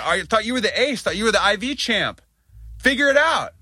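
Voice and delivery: high mocking taunt; scoffing at the so-called IV champ; sarcastic jab. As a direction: needle them with high-pitched sarcasm. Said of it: "And I'm like".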